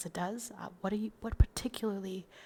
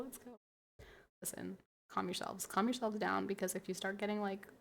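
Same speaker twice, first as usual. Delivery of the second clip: ordinary delivery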